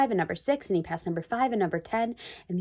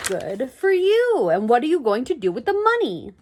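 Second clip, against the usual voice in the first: delighted; 4 semitones higher, 6 dB louder than usual. A narrator's reading. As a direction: cheer the boy's win. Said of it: "cried Doctor Moore".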